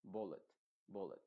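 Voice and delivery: bullet, reduced ending. Shown as a demonstration. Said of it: "sino bullet".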